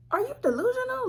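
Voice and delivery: high, disbelieving retort; incredulous. Retold or imitated. Retelling how she answered her mother, incredulous and high.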